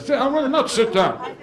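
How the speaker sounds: a fed-up relative yelling at the uncle; exasperated shout. Acted. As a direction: nag him down, fed up.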